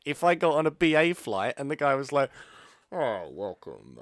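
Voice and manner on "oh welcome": low, slow pompous captain voice; pilot voice. Retold or imitated. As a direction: play a smug pretend pilot. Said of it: "practicing his pilot voice".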